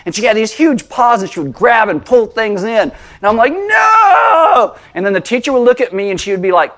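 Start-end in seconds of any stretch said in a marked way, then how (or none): 3.5-4.8 high, shouted NO; panicked no. Retold or imitated. Pastor retelling being dragged by his dog, high shout. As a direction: yell it as your chair gets dragged away. Owner dragged across the floor.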